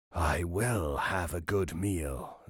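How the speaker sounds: low, slow hungry wolf. Acted. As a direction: growl it out slowly like a starving wolf.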